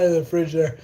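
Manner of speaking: breathless and laughing, gasping between words; out of breath, laughing it off. Real, not acted. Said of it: "I'm completely out of breath".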